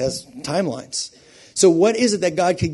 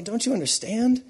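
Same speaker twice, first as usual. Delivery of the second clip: higher, rising pleading question; pleading retort; 8 semitones higher than usual. Acted. Believer answering mocking friends, pleading. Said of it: "And you're like".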